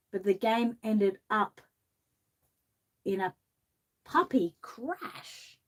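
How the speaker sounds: lilting storybook read-aloud; gentle sing-song picture-book reading; reading to children. Acted. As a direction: read the rhyme like bedtime for kids.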